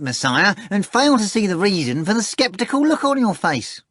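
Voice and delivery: high, loud wheedling cat voice; a fat cat bragging and wheedling; boastful cat Behemoth. Acted. Behemoth swearing to Voland, high wheedling cat voice. Cat lists rescued items.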